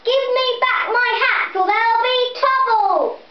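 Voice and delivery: high, loud singsong threat; a child's angry hat seller. A narrator's reading. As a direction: warn the monkeys there'll be trouble.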